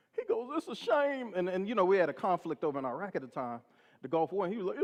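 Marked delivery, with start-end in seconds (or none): 0.6-1.3 high mock-tearful lament; hammed-up weepy voice, pitched high; imitated crying cop. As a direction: lament it, pretending to cry. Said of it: "this guy starts crying".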